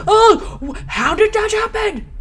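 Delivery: sudden high, loud surprise; jolted by what just happened; startled yelp. Real, not acted. Gamer yelps at a sudden scare, startled.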